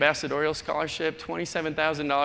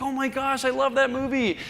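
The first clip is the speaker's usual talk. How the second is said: higher mock fan voice; gushing stranger; 8 semitones higher than usual. A movie fan gushes at the Fargo native, higher mock voice. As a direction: play the starstruck tourist, higher. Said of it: "They're like".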